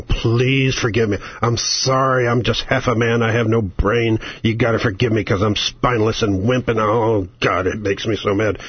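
mock groveling senator. Mocking a Democrat senator begging Republicans for forgiveness, low groveling.

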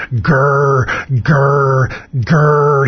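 low, lightly growled grr grr grr; mock bear growl. Host mocking Al Franken's bear noises, low mock growl. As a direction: sneer at the bear noises by copying them. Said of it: "growling like a bear".

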